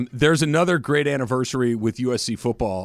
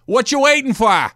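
put-on Boston accent; 10 semitones higher, 7 dB louder than usual. Host heckling his co-host in a loud Boston accent. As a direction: go full Boston, needling your buddy. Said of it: "do the stupid accent".

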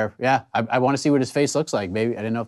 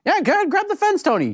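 yelling at the screen. Real, not acted. Yelling advice at fighter on screen.

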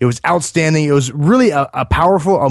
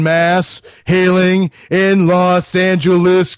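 slow, drawn-out higher circus announcer call; ringmaster; 4 semitones higher, much slower than usual. Pretend. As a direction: stretch every word like a big-top ringmaster. Introducing the guest like a ringmaster.

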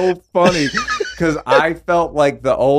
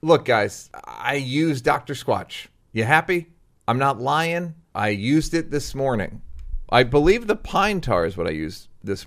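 casual, softer sponsor read; 3 semitones lower, 3 dB quieter than usual. Acted. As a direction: sell the soap to listeners, easygoing. Host reads sponsor ad.

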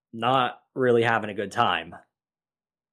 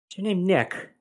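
high, distant, vacant question; hollow shell-shocked voice; 8 semitones higher than usual. Retold or imitated. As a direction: ask it staring right through him. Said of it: "thousand-yard stare".

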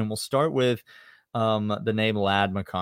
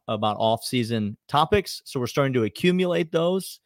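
ordinary talk, nothing marked

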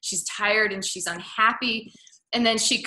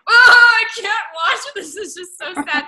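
much higher, louder anguished cry; distressed exclamation; 11 semitones higher, 13 dB louder than usual. Retold or imitated. Telling a friend how the movie upset her, high loud anguish. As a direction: cry out like it hurts to watch. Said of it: "I was like".

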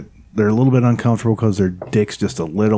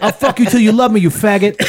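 Mike Tyson; 9 semitones higher than usual. Retold or imitated. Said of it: "That's Mike Tyson said that".

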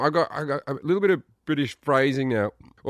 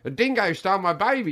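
higher-pitched mock American quoting of a famous line; mocking quote; 5 semitones higher than usual. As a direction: parrot the line back teasingly, pitched up. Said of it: "when Americans just reference that line".

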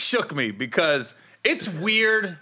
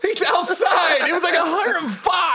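higher and louder, laughing; laughing shout; 9 semitones higher, 4 dB louder than usual. Real, not acted. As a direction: argue it through laughter. Heated laughing argument.

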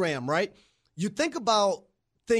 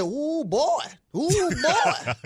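put-on Louisiana accent; 8 semitones higher, much slower than usual. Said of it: "You know he got that Louisiana accent".

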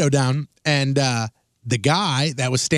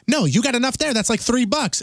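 retold protest; 7 semitones higher than usual. Retold refusal to panhandler.

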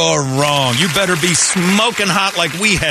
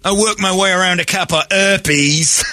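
high, broad put-on Cockney; hammed-up Cockney voice; Cockney accent; 6 semitones higher than usual. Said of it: "Yeah, she's Cockney".

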